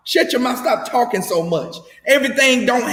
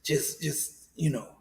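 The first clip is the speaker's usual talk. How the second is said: much lower, much softer near-whisper; late night DJ voice; 8 semitones lower, 13 dB quieter than usual. Retold or imitated. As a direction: breathe it out like a seducer. Pastor seducing in a hush.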